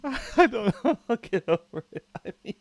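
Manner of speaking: high and loud, words breaking into laughter; laughing at his own rejection; laughing loudly. Real, not acted. Streamer telling chat both nations refused him, laughing loudly.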